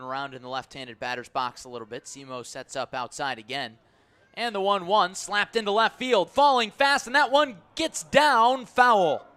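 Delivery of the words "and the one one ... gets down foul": much higher and louder, slowing as it drifts foul; peaking radio call into relief; excited play call. Real, not acted. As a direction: build on the slap, then let it hang foul. Live call of near hit.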